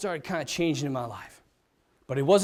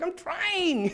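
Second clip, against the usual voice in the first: put-on whiny little boy. Boy keeps losing.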